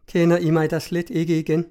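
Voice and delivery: usual speaking voice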